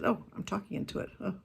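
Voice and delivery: breathless whisper to herself; realizing mid-talk, whisper it; whispered aside. Real, not acted. Speaker noticing she's holding her phone, whispered. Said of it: "I don't even know where it is".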